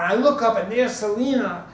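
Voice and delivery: ordinary delivery, nothing marked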